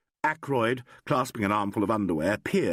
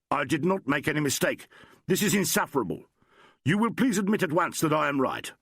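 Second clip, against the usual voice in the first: indignant; 6 semitones higher than usual. Acted. Doctor angrily demands an admission.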